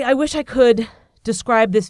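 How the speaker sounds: ordinary talk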